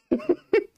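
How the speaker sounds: sudden squeaky laugh; high burst of laughter. Real, not acted. Laughs at own question.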